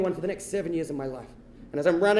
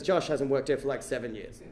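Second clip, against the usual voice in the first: low, soft bouncer voice; imitated doorman, low-key; deadpan bouncer; 9 semitones lower, 8 dB quieter than usual. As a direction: drop low and give it unimpressed.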